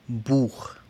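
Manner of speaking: Buch ending in a throaty ch like Spanish j; Buch, ch as in Juan; German ach sound. Shown as a demonstration. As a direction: scrape the ch of Buch at the back. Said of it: "el mismo que tiene la J en español".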